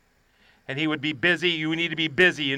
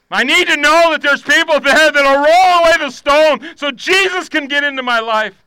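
raised, pleading preacher's voice; impassioned preaching; 7 semitones higher, 8 dB louder than usual. Acted. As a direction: swell higher and louder into an urgent plea.

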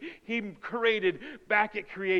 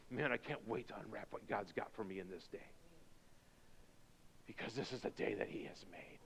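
low, excited whisper; hushed anticipation; 11 semitones lower, 20 dB quieter, faster than usual. Acted. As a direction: whisper eagerly on waking. Acting waking in hushed anticipation.

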